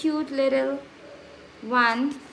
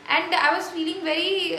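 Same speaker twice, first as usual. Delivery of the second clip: ordinary delivery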